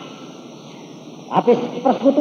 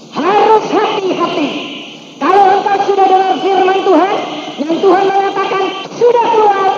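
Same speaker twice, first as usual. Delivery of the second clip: preaching; 9 semitones higher, 5 dB louder than usual. Acted. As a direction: drive the warning home to the church. Preaching at congregation.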